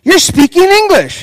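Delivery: high, loud outraged accusation; accusing shock. Retold or imitated. Pastor accusing a congregant, loud and high. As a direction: cry it like you've been betrayed. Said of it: "He's like".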